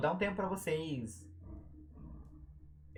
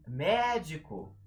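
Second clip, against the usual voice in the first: MÉDICO with a long, louder MÉ; stretched stressed syllable; 3 semitones higher, 5 dB louder, much slower than usual. Shown as a demonstration. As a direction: hold the first syllable of "médico". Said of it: "A gente sempre estende a sílaba tônica".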